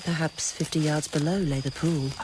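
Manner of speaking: usual speaking voice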